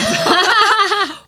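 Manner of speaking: high laughing burst; burst of laughter. Real, not acted. Recalling her childhood line, she bursts out laughing. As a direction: break into loud laughter.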